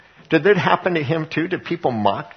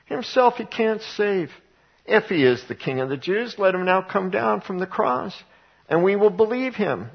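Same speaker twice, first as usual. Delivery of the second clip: mocking chief priests; 6 semitones higher than usual. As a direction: sneer it to the crowd. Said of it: "also mocking with the scribes".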